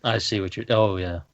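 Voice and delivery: usual speaking voice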